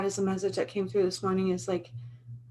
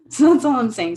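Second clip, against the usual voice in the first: bursting out laughing, louder; 7 semitones higher, 10 dB louder than usual. Real, not acted. Woman joking about being a pothead, laughing. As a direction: laugh at your own joke.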